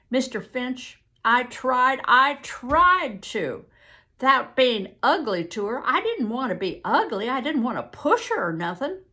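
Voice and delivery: loud, emphatic Southern plea; pleading witness. Acted. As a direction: insist desperately that you meant well.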